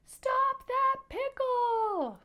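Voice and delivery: high, loud, drawn-out yell; upset shout. Shown as a demonstration. Mister Adolph gives chase to the pickle, upset high shout. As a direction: shout it after a runaway, very high and stretched. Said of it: "Can you say it like he did?".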